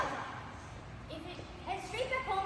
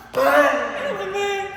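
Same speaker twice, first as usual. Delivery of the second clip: shouted cry; 2 semitones higher, 13 dB louder than usual. Acted. City cries out.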